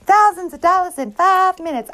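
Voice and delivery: mock the easy-money promisers; mock get-rich hustler. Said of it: "These people out here that are telling you".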